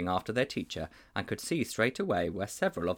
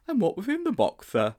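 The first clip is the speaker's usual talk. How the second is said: put-on high boyish voice, much higher; a puzzled schoolboy asking his teacher; high, wide-open boy's question; Sponge's voice; 11 semitones higher, 4 dB louder than usual. A narrator's reading.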